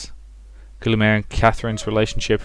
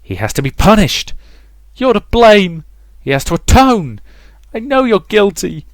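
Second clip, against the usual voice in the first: high, loud, furious condemnation; mounting anger; 11 semitones higher, 9 dB louder than usual. A narrator's reading. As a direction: shriek for punishment. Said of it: "Mother inside her head building up her anger".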